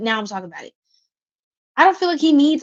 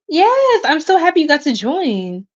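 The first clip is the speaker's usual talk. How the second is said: much higher and louder, thrilled; joyful welcome; 6 semitones higher, 6 dB louder than usual. Real, not acted. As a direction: burst out happily to greet her. Greeting a viewer with joy.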